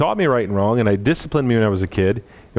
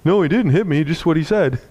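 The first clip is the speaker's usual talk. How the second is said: put-on high, whiny kid denial; 6 semitones higher than usual. Crying bully admitting to his father, high whiny voice. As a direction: whimper it like a caught-out child. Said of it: "I remember the kid going".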